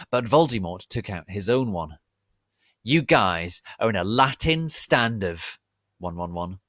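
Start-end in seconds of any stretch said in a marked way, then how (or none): 2.8-6.6 despairing shout. Acted. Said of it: "I shouted despairedly".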